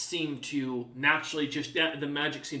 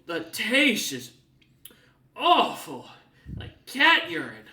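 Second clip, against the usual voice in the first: slow, higher, louder, spitting out the words; sputtering disgust; 5 semitones higher, 7 dB louder, slower than usual. Acted. Old man after drinking the potion, sputtering in disgust. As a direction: gag on the foul taste. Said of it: "sputters a little bit".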